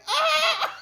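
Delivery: high, laughing yelp of fake pain; mock pained yelp. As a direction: squeal like stiff fingers hurt. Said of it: "I'm like".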